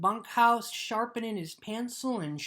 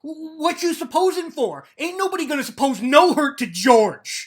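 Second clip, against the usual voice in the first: loud, higher, furious outburst; angry shout; 7 semitones higher, 11 dB louder than usual. Acted. Lenny warning Crooks off talk of George, angry shout. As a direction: yell it at him in rage.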